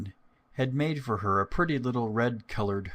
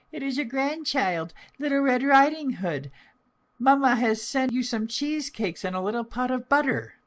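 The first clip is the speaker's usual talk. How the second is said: put-on much higher, louder little girl voice; 4 dB louder than usual. Girl at grandmother's door, high child voice. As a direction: answer brightly like a small child. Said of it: "so she answered".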